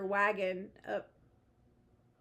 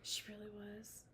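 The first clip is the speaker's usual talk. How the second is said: unvoiced hushed whisper; 15 dB quieter than usual. Real, not acted. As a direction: whisper it like gossip. Whispered gossip.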